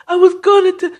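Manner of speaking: high-pitched mock excuse, cut off; whiny pleading. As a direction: imitate a tearful friend trying to explain. Acted crying friend trying to explain.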